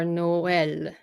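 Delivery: "Noël" in two syllables, no-EL; separate vowels. Shown as a demonstration. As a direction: break "Noël" between O and E. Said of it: "the E as its own syllable".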